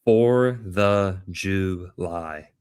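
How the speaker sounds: For the July drawn out syllable by syllable, very slow; sounded out slowly. Shown as a demonstration. Sounding out For the July for listeners. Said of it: "sound this one out with me".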